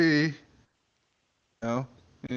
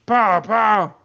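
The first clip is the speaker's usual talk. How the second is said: gunshots; 8 semitones higher, 8 dB louder than usual. Acted. Gunshots imitated.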